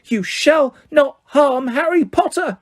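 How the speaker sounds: high, slow elf voice, a mild defiant cry; Dobby shouting. A narrator's reading. Elf shielding Harry from Malfoy, defiant. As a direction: stand up for Harry, slow and firm. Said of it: "But Dobby shouted".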